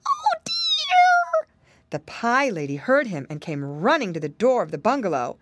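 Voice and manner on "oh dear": very high frightened piglet squeal. A narrator's reading. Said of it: "all he could do was squeal".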